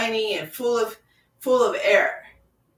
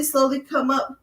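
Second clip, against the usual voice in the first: normal talking voice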